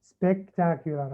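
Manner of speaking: "Spectacular" very slowly, spec-tac-u-lar; syllable-by-syllable. Shown as a demonstration. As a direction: stretch "Spectacular" so each syllable can be counted. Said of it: "بنشوف كم مقطع". (نطق "Spectacular" ببطء شديد مقطعًا مقطعًا)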